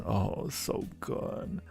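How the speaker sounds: usual speaking voice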